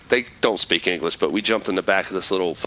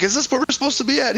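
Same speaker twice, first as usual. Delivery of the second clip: worried question; faster than usual. Retold or imitated. Asking the lieutenant, retold.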